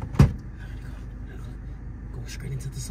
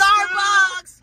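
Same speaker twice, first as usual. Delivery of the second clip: higher, louder excited Starbucks; 7 semitones higher, 8 dB louder than usual. Acted. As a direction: squeal Starbucks like a fan. Excited repeat of the choice.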